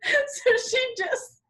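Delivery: high, laughing till tears; laughing so hard it cries; crying with laughter. Real, not acted. Helpless laughter telling story.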